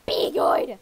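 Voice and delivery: loud breathy call of be good; yelled farewell. Acted. Teacher shouting goodbye to kids at hospital, loud. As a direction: shout it after the kids as you leave. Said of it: "She's yelling at us".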